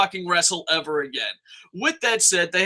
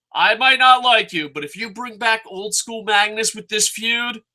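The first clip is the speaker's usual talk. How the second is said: usual speaking voice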